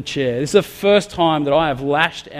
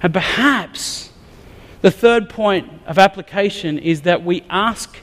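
building preaching; 5 semitones higher than usual. Acted. Pastor urging his church toward God, rising preach. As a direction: rise to an urgent call to the congregation.

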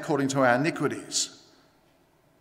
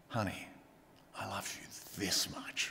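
very soft, low and intimate; hushed, loving reassurance; tender parent; 10 semitones lower, 18 dB quieter than usual. Acted. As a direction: answer a little child gently, almost under the breath. Parent answering child's question tenderly.

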